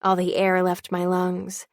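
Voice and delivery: ordinary delivery, nothing marked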